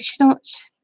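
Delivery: put-on high voice for talking to a child; retold grandma-to-child explanation; grandma explaining. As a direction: explain to a grandchild, pitched well up. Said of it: "I'm like".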